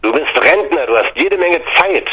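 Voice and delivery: sudden retort, pitch up; blurted out. Retold or imitated. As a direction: let it burst out of you. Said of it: "platzte es aus mir heraus".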